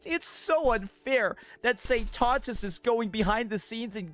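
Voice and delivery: lightly hammed-up moaning victim; mock whining victim. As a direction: whine it like a sore loser. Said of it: "moaning and complaining about being a victim".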